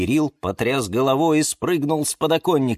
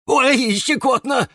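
high, loud ticklish squeal; giggly; 5 dB louder, slower than usual. A narrator's reading. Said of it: "хихикнул он".

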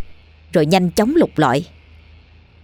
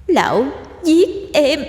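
high, echoing, drawn-out ghost voice; wronged ghost girl; 9 semitones higher than usual. Acted. Ghost girl naming her killer, high echoing voice. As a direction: repeat the accusation slowly and eerily. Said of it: "Hà lặp lại".

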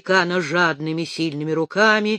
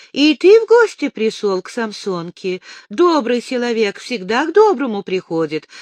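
put-on raised, loose drunk voice; tipsy Ostyak voice; 5 semitones higher, 6 dB louder than usual. A narrator's reading. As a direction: slur it like a happy drunk.

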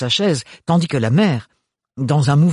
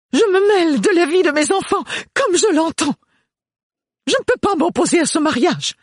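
much higher and louder outburst; exploding mother; 4 dB louder than usual. A narrator's reading. The mother exploding at Thorne, much higher and louder. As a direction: boil over at him. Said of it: "sa mère explosa".

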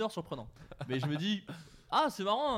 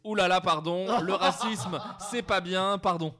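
mock apology; 4 semitones higher, 9 dB louder, slower than usual. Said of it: "je comprends pas le ton de la phrase".

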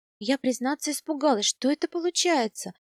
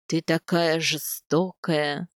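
lower, slow mock-wounded moan; 6 semitones lower, much slower than usual. A narrator's reading. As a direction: play hurt, slowly, as Ayaz. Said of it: "простонал степняк". (медленно, с притворной обидой, пониженным голосом)

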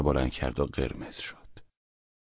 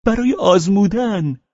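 high, louder blurted answer; Pavel Pavlovich blurting; 5 dB louder than usual. Acted.

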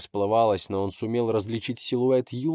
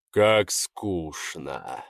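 low, very slow, icy dragon; cold dragon voice; 4 semitones lower, much slower than usual. Acted. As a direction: drawl it out coldly, lower. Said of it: "холодно произнёс дракон".

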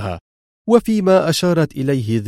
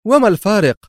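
higher, louder puzzled question; surprised question; 9 semitones higher, 4 dB louder, faster than usual. Acted. Laura asking Mr X what difference it makes, surprised. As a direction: ask in genuine surprise. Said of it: "متسائلة في دهشة".

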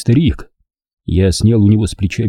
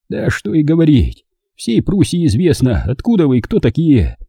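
put-on higher, worn old man's voice; 6 semitones higher than usual. A narrator's reading. Captured old man explains himself to partisans, higher old voice. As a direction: play the frightened old German.

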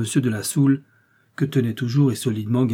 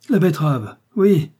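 higher, louder triumphant yes; amused confirmation; 5 semitones higher, 5 dB louder than usual. A narrator's reading. Detective gleefully confirms his disguise to Raoul. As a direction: confirm it with glee.

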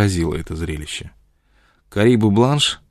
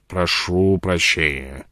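put-on very slow, drawn-out drunken apology; tipsy, appeasing drawl; placating mumble; 3 semitones lower, much slower than usual. A narrator's reading. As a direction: mumble it soothingly, words stretched. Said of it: "бормотал Джек Корнелл умиротворяюще".

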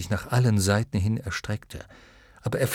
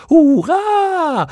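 shrill crowing cheer, very high and loud; gekrähtes Hurra; 9 dB louder than usual. Acted. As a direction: crow it out like a flock of clown moths. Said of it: "krähten sie". (schrill gekrähtes Hurra eines Mottenschwarms)

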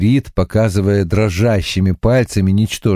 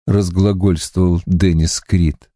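ordinary delivery, nothing marked

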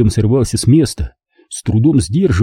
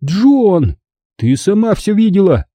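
exclaiming; 8 semitones higher than usual. Acted. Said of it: "воскликнул я".

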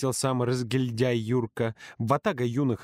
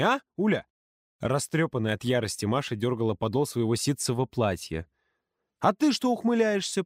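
snide jabs; 6 semitones higher than usual. Acted. Ульяна taunting the girls, high sarcastic jabs. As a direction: needle them sarcastically. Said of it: "язвила Ульяна".

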